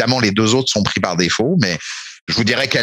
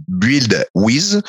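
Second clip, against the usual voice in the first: French-accented English. Shown as a demonstration. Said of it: "excusez-moi de mon accent peut-être un peu merdique".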